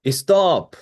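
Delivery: high, loud mock stop; sharp stop. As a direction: cut it off with a shrill stop. Mock reaction to similar accents.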